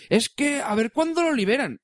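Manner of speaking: high, drawn-out mock complaint; put-on whiny-user quote, pitched well up; imitated complainers. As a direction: mimic impatient users, slow and high. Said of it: "dicen, por ejemplo, el otro día me comentaban".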